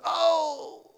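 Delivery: high squealed oh with a laugh; startled cry. Retold or imitated. Retelling the woman's cry in the mall, high startled yelp. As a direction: mimic the woman's sudden yelp.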